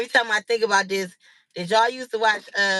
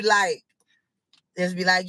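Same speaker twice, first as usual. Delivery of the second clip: ordinary talk, nothing marked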